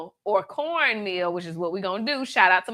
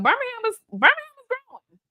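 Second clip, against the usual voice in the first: hyped up; 13 semitones higher, faster than usual. Real, not acted. Hyping her city.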